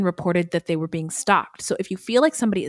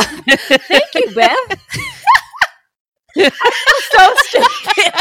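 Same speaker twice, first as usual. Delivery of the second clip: high-pitched giggling through the words; words breaking into real laughter; laughing at herself; 9 semitones higher, 6 dB louder than usual. Real, not acted. Host cracking up at her own oversight, laughing.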